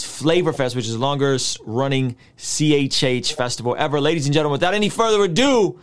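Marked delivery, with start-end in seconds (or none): 4.0-5.8 announcer introduction. Real, not acted. Host presenting a special guest to listeners, announcer introduction. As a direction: build to a big guest reveal.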